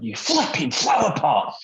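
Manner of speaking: high, loud, drawn-out retold curse; mock angry yell. Man cursing a seagull before girlfriend's parents, mock angry yell. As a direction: yell a hastily censored insult.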